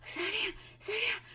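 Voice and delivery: high gasping plea; breathless. Acted. Missus Salt out of breath begging Wonka, gasping.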